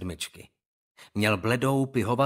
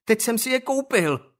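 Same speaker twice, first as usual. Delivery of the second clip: put-on a small boy's higher pleading cry; cried out in a higher voice; 10 semitones higher, 4 dB louder than usual. Younger boy protests to the bullies, higher cry. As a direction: yelp it, young and upset. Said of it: "vykřikl nějaký vyšší hlas".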